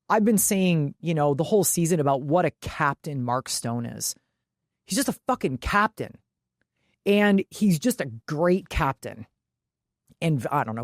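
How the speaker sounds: ordinary talk, nothing marked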